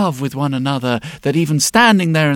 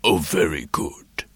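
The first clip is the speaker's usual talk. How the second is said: dragon voice; 10 semitones lower, 5 dB quieter than usual. Acted. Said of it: "said the dragon".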